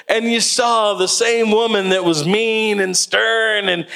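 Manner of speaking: normal talking voice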